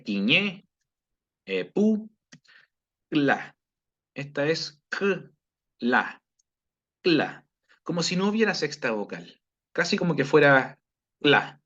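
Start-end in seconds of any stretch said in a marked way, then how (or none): 4.2-7.4 cla with a puff of breath between k and l; breathy kla. Shown as a demonstration. Teacher showing students the puffed k in cla. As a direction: push air out between the k and the l of cla. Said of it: "con una expiración más grande".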